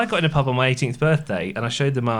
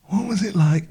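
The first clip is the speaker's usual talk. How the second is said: failed Podfather voice; 6 semitones higher than usual. Pretend. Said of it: "do it in the voice".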